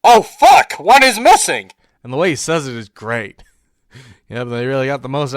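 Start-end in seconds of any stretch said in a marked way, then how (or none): 0.0-1.7 loud, high panicked yell; hammed-up alarmed shout; mock soldier. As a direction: parody a soldier in sudden alarm. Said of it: "the soldier's like".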